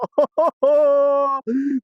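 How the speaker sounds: long, high-pitched squealing laugh; elated laughter. Real, not acted. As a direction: laugh out loud in pure joy. Overjoyed at the coin find.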